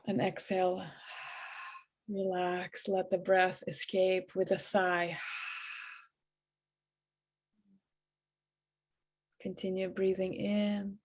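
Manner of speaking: soft, hushed meditation voice with long pauses; guided meditation. Acted. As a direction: ease them into relaxation, quietly and evenly. Leads a guided breathing meditation.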